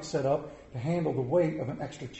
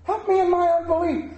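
pitched up high, pleading to God; higher pleading prayer; 11 semitones higher, 4 dB louder than usual. Shown as a demonstration. Preacher praying to Father, pleading higher. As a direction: beg higher the second time. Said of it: "And I have to say".

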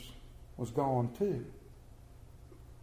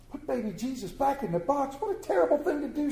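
put-on higher, appalled child voice; shocked children; 8 semitones higher than usual. Children horrified at putting Jesus back, higher and appalled. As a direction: gasp it out like a horrified kid.

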